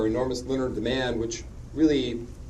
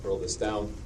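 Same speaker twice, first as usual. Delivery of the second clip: normal talking voice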